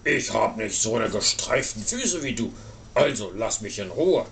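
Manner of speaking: low grumpy walrus voice; put-on deep walrus grumble; grumbling walrus. A narrator's reading. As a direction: grumble deep, as a big animal wanting peace. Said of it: "grumpelte Frau Walross".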